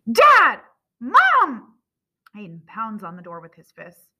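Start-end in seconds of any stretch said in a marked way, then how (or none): 0.1-1.7 high, loud cries for parents; urgent, full-voiced calls; desperate shouting. A narrator's reading. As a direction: yell through the locked door. Locked-in son shouts, pounding door.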